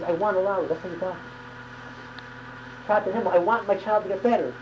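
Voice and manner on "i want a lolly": ordinary talk, nothing marked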